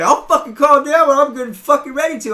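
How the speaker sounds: shouting down the card shop owner; yelling. Retold or imitated. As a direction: scream it back in his face. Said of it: "I started fucking yelling".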